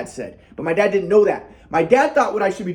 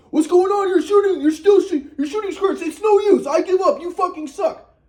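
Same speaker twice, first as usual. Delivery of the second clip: much higher, whiny mock dad voice; 10 semitones higher than usual. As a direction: whine it like a fed-up parent. Dad mocked giving up.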